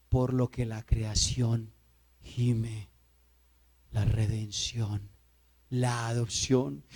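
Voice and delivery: low, soft and slow; grave, quiet sermon delivery with long pauses; hushed solemn preaching. Real, not acted. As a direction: drop right down and weigh each phrase. Preacher drops to solemn hush.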